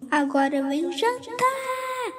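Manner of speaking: high, slow call to dinner; mother calling the boys to dinner; mother's call. A narrator's reading. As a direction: call out across the house. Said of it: "gritou a mãe".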